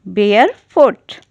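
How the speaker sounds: barefoot stretched out slowly; slow model repetition of barefoot; very slow teaching pace. Shown as a demonstration. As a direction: draw barefoot out syllable by syllable. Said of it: "नंगे पाँव को कहते हैं".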